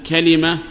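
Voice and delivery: كلمة as "kalima"; three syllables in كلمة, ka-li-ma; first dialect form. Shown as a demonstration. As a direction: say كلمة with a, then i. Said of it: "ما كان على وزن فعل".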